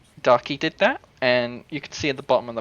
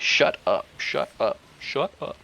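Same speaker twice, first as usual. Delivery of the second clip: deadpan Sol impression. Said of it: "in this game's case".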